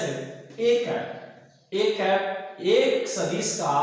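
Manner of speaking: very slow, stretched words; drawn-out example. Shown as a demonstration. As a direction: draw each word out for the students. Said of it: "teacher drawing out an example". (बहुत धीमे, खींचकर)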